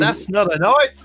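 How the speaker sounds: slow, loud fake Australian quote; loud, slow put-on Australian accent; mock Australian drawl. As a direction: ham up the movie line in an Aussie accent. Crocodile Dundee quote.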